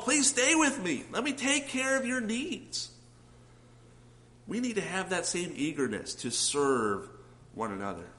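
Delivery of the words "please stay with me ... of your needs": pleading Lydia. Retold or imitated. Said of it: "practically begs Paul".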